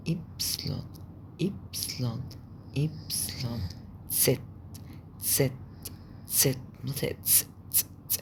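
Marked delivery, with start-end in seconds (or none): 0.1-3.6 soft letter drill. Shown as a demonstration. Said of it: "repeating letter names quietly". 6.8-8.2 تس hissed voiceless, t and s fused; ts sound. Shown as a demonstration. Teacher showing how the German Z sounds. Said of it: "كأنك بتنطق تي اس".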